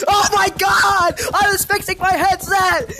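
high-pitched indignant protest; exasperated outcry. Real, not acted. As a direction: wail it at being caught out. Protest on being caught in game.

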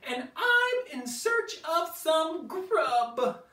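very high, loud little dino voice; little Stanley voice. Acted. Baby triceratops answering the T-Rex, very high and brave. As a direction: pipe up bravely like a tiny triceratops. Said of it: "said Stanley".

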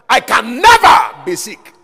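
shouted, high and drawn-out declaration of faith; bold declaration. Retold or imitated. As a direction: thunder it out like a preacher declaring boldly. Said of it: "You hear God's servant say all the time".

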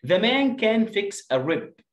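slow teacher read-aloud; slow, high model reading. Shown as a demonstration. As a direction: spell it out slowly to the class.

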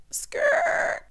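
very high screech of brakes; mock record-stopping screech; imitated tire screech. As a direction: squeal like tires braking hard. Imitates screeching brakes, halting the story.

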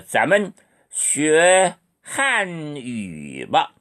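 very slow, syllable by syllable; slow classroom model; slow model sentence. Shown as a demonstration. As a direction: drill it slowly for students. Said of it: "읽으세요".

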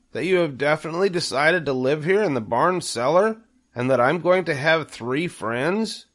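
high, hopeful question; pig's voice pitched well up; Wilbur the pig, hopeful. A narrator's reading. Wilbur asks the new spiders, high and hopeful. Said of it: "asked Wilbur".

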